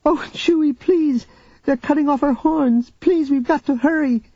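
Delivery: put-on high, begging child voice; desperate child. Jaina begs Chewie to hurry, high pleading. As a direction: plead urgently like a frightened girl.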